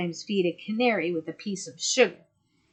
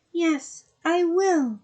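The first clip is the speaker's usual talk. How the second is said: put-on higher, trembling young voice; 10 semitones higher, 4 dB louder, much slower than usual. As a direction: answer with a tremble. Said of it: "in a trembling voice".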